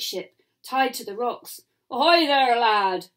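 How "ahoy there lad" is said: loud pirate hail; pirates calling. A narrator's reading. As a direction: hail the boy like a pirate crew. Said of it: "the pirates cried".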